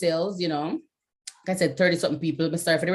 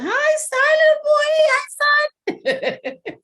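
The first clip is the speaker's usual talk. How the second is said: much higher, slow sing-song hello; squeaky playful greeting; 6 dB louder, much slower than usual. Real, not acted. Host teasing a silent attendee with a squeaky high hello. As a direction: coo a teasing hi at him.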